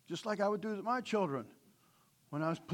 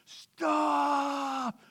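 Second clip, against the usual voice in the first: raised, higher call of stop; mild mock yell; 7 semitones higher, 3 dB louder than usual. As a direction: yell it like on the highway. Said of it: "I'm screaming".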